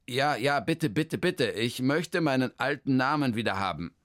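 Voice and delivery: a hint of a flustered man; lightly voiced, hesitant man; Hermann. Hermann stumbles over his long new name, lightly voiced.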